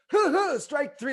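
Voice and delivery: high, slow mock umpire call; hammed-up umpire calling strike three.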